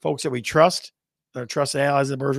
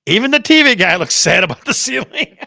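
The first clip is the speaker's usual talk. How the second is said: higher and louder, laughing through the caption; reading the winner while laughing; 6 semitones higher, 7 dB louder than usual. Real, not acted. Host laughing while reading out the winning caption. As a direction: announce it, cracking up. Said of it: "and the winner is".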